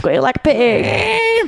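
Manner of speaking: high, very drawn-out Southern squeal; mock hillbilly movie quote, pitched high. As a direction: stretch the last word into a squeal. Echoes Deliverance line as a squeal.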